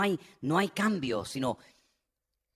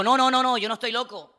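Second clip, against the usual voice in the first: forceful denial; 6 semitones higher, 3 dB louder than usual. Retold or imitated. Paul denies being mad.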